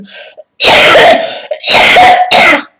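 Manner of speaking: loud, harsh real cough; hard coughing fit. Real, not acted. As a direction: cough hard, breaking off mid-speech. Ill, coughing fit while talking.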